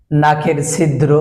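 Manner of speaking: ordinary talk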